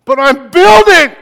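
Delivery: loud, high protest; defensive shout. Retold or imitated. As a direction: shout back, defending yourself. Defensive retort in retold scene.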